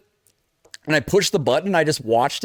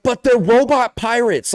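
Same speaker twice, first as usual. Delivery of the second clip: slow, high, disbelieving whine; slow raised whine of disbelief; incredulous protest; 7 semitones higher, 3 dB louder, slower than usual. Retold or imitated. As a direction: protest it like it's obvious. Retorting to companion in game.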